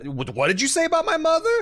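high, loud, hammed-up offended shout; a jokey take on sudden fury; mock outrage. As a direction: play the insulted man flaring up. Acted diplomacy quarrel.

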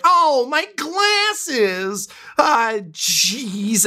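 put-on very high, drawn-out squeak of a clumsy kid; flustered urchin. Urchin squealing after his glasses are knocked off. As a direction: squeal like a panicked little urchin who lost his glasses.

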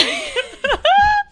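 squealing laugh at your own joke; real high-pitched crack-up; high loud laugh. Real, not acted. Laughs after her own remark.

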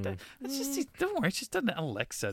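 high, hushed mock reassurance; a put-on robot butler voice, high and soft; mock soothing AI. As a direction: coo it like a creepy home computer. Soothing voice of the creepy AI.

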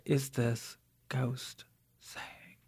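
slow eerie whisper; spooky whisper. Acted. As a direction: whisper it like a ghost story. Introducing a ghost recording.

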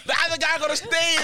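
high, quick mimicry of a ranter; high-pitched mocking imitation. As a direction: parrot him fast and high. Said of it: "This high-pitched voice".

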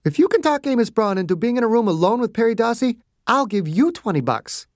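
doctor daring the guard, playful; teasing Margaret. A narrator's reading. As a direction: tease him with the dare. Margaret teases Clarence.

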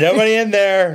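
mock bystander shout, high and loud; imitated yell. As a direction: holler it like a heckler in the background.

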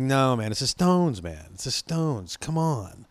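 a hint of stoner insistence, softening as it goes. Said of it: "he sounds just like [name]".

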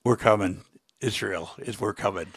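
low, soft and grave; quiet warning. Real, not acted. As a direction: deliver a hushed, understated warning. Grave message to the president, first take.

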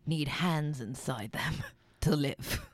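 a light mock Michael Caine accent, slowly; Michael Caine impression. Co-host adds a line in a mock Michael Caine accent. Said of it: "let's talk like Michael Caine".

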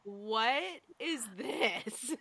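very slow, drawn-out disbelief; mock bewilderment. Crew hearing actor's strange voice on set, mock bewilderment. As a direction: imitate a baffled crew, stretching each word. Acts out crew's baffled reaction.

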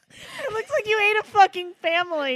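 ordinary talk, nothing marked

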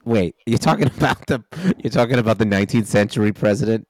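rushed, baffled double take; fast confused question. Acted. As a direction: blurt it out quickly. Ghost character confused, rushing.

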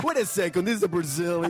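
high, mock-outraged doubter; hammed-up objection; imagined suspicious viewer. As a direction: pitch it up as if catching a fake. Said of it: "nobody's gonna find out. I'm like".

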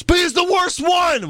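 high-pitched mock outburst; imitated yell. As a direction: yell it out, mimicking a wrestler's complaint. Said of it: "and Owens yells".

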